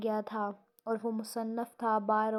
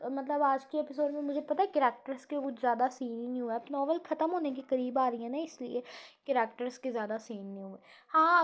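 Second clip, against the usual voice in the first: ordinary talk, nothing marked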